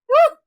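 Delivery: short, loud, high whoop; startled whoop. Retold or imitated. New guy jumping into coworker's arms, startled whoop. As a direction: yelp it as if leaping in fright. Imitates his startled leap.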